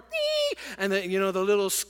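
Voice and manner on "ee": piercing high squeal for the frequency; imitated high tone. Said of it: "Seventeen point four".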